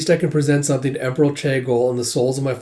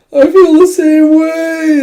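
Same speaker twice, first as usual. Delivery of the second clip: mock high voice; 11 dB louder, slower than usual. Drawn-out high goofy voice.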